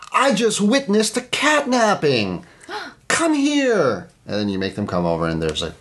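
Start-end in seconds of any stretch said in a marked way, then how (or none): 0.1-4.1 high, slow, hammed-up alarm at a neighbor's door; mock panic. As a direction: play it like a fake emergency. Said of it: "in a panic you say".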